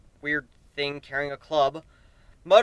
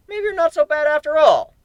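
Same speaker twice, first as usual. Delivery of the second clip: much higher, louder laughing praise; 12 semitones higher, 9 dB louder than usual. Acted. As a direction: cheer him on with a laugh.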